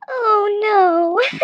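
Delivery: half cry, half laugh. Real, not acted. Cracking up at the worm step, laughing mock horror.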